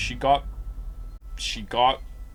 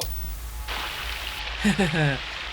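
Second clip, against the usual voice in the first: evil laugh; 3 semitones higher than usual. Acted. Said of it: "here comes the evil psychopath voice".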